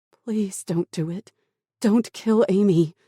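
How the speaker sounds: high, distressed pleading; last plea. A narrator's reading. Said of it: "Tara pleaded one last time".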